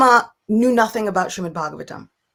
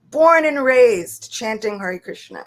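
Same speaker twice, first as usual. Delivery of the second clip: ordinary delivery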